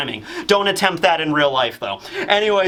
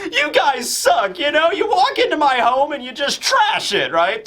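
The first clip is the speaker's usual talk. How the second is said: whiny Kaido; 12 semitones higher than usual. Acted. Kaido complaining his home got trashed.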